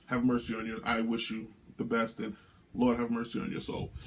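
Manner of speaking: soft, low solemn blessing; grave pity. Real, not acted. Host pitying a mouthy rookie, grave and hushed. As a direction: drop your voice as if praying for him.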